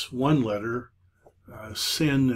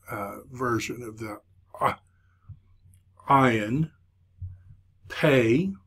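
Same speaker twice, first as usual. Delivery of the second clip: usual speaking voice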